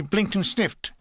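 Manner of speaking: usual speaking voice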